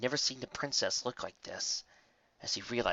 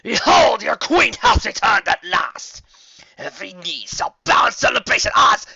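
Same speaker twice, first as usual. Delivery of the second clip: proud queen proclaiming; 11 semitones higher, 14 dB louder than usual. A narrator's reading. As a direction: thunder it down at the crowd. Queen proclaims her return.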